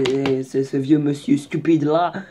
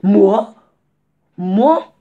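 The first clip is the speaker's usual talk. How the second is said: loud, higher indignant cry; indignant Moi; 6 semitones higher, 9 dB louder than usual. Retold or imitated. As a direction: protest loudly, offended. Mimics himself now, offended. (protestation forte et indignée)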